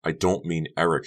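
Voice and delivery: usual speaking voice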